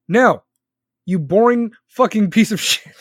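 loud retort breaking into laughter; mock-abusive outburst. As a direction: burst out with a joking insult. Mock retort to his own impression.

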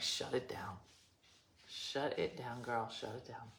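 low, soft mock order; hushed conspirator quote. Vlogger imagining who cut her internet, hushed mock order. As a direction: play a secret plotter, low and quiet. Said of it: "They were like".

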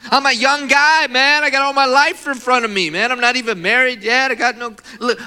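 loud, high-pitched pleading outburst; anguished protest. Retold or imitated. Injured young man lamenting his future, loud and distraught.

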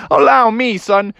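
high, cocky dad voice; showing-off dad. Acted. Dad takes over from his son, high show-off voice. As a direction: step in like a show-off father.

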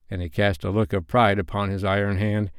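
ordinary delivery

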